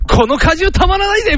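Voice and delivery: high, cocky cartoon-fighter impression; ベジータ. Anime warrior pitching juice in an ad, mock heroic. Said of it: "みたいな感じでベジータが".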